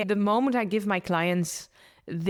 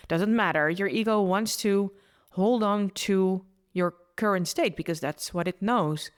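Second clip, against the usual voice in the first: normal talking voice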